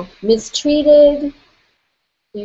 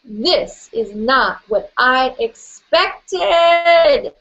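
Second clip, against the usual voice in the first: very slow, each word landing, expected drawn out; slow, weighty realisation; 3 semitones higher, much slower than usual. Shown as a demonstration. Coach repeats the phrase so the group feels it land, very slowly. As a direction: let it sink in word by word.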